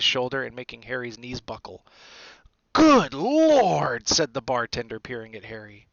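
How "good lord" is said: loud, high, drawn-out amazement; astonished. A narrator's reading. Bartender peering at Harry, loudly astonished. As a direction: exclaim it in sudden wonder.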